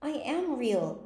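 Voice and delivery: high, slow, upset protest; near tears. A narrator's reading. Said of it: "and began to cry".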